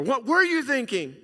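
high, loud exasperated parent; what were you thinking, thrown high and loud; scolding parent. Shown as a demonstration. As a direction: scold a son who messed up.

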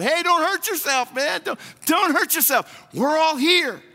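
high, loud urgent shout; Paul yelling out. Retold or imitated. Paul shouting to the jailer to stop him, high and loud. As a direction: shout to stop a man harming himself. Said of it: "And Paul yells out".

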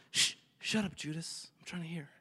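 irritated whisper fading very soft; hushed shushing. Acted. Peter shushes Judas on the noisy boat, hushed. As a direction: shush a companion so you can listen. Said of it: "He's like".

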